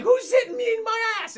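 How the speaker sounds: high, loud whiny shout; high yelping complaint, hammed up; outraged yelp. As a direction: squeal it like a startled thug. Henchman hit, freaking out.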